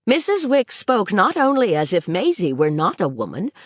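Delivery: ordinary delivery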